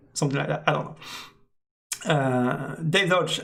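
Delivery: ordinary delivery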